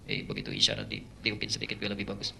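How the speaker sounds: low tempting whisper; devil's whisper. Retold or imitated. Devil whispers to the imam to embellish his voice.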